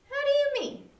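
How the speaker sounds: a lightly voiced friend caught off guard; Joshua puzzled.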